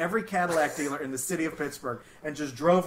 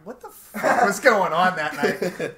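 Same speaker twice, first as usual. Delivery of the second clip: slow, louder, baffled question; bewildered demand; 4 semitones higher, 9 dB louder, slower than usual. Retold or imitated. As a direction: drag it out like a stunned old mom. Imagined mom on deathbed.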